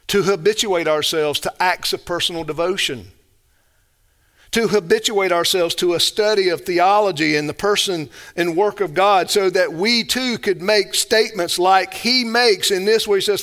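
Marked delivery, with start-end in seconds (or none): none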